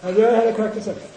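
rapid question. Shown as a demonstration. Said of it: "repeats faster".

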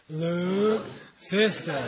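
drawn-out very slow, each syllable spaced; slow read-along. Shown as a demonstration. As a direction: lead it slowly for the class. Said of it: "How about this one? One, two, three".

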